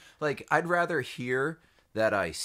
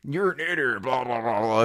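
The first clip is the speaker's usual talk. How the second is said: mock insult; 7 dB louder than usual. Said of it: "and, uh".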